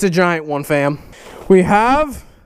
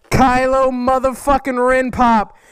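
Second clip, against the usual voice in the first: ordinary talk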